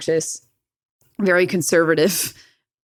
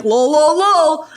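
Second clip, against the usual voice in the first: loud, high, rising sing-out; playful over-the-top voice, climbing higher; mocking dad voice; 8 semitones higher, 5 dB louder, slower than usual. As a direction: imitate a dad brushing off anger. Imitates dad's flippant reply.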